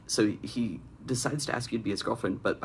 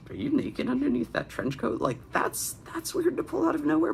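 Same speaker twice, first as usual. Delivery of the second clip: incredulous. Real, not acted. Incredulous reaction to the fanfic.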